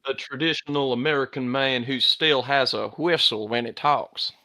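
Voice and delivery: drawled American accent, Texas style. Shown as a demonstration. Australian showing friends a traditional American man, Texas accent. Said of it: "Texas, mate. ... Definitely Texas".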